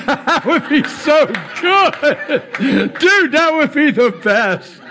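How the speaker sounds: high, drawn-out delight through laughter; laughing. Real, not acted. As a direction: crack up while gushing over the idea. Joking, laughing at his own idea.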